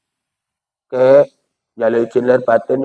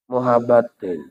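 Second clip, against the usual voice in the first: softly mocking; 4 semitones lower, 6 dB quieter, slower than usual. Shown as a demonstration. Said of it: "Kok kayak film India ini?".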